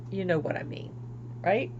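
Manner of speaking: normal talking voice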